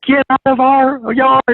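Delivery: high, loud, drawn-out mock yell; mom yelling. Mom kicking the coach out of the yard, mock yell. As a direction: imitate mom hollering, stretching the words. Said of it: "I still remember my mom".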